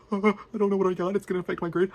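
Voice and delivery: high, fast, fretful student voice; mock panic. Student imitating panic over a grade, high and fast. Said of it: "Bad stress would be like".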